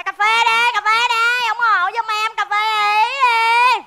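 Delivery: high, sustained sales call; vendor's street cry. Acted. Café seller calls passers-by to buy coffee, drawn-out hawking. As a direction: sing out for customers, stretching the last word.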